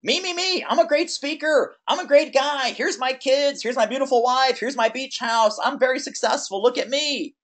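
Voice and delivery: high, boastful show-off voice; mock self-centered bragging. Coach mocking a client's old me-centric pitch, high and boastful. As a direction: brag about yourself in a high preening voice. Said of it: "he started out kind of me-centric".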